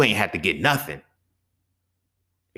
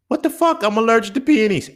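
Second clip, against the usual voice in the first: high, loud outraged yell; shrill outburst; 6 dB louder than usual. Retold or imitated. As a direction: scream it out in shock. Girlfriend voiced breaking out in hives.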